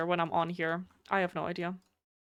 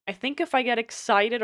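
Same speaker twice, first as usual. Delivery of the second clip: ordinary delivery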